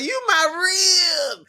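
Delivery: high, slow mock Jamaican-accented line; imitating Taye Diggs's character. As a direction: ham up the movie character lovingly. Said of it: "when he was like".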